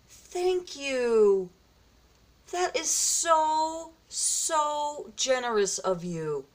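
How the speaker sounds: high, overwhelmed disbelief; real, moved amazement at a gift; drawn-out, high-pitched gratitude, close to tears; tearing up, astonished. Real, not acted. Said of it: "tearing up here".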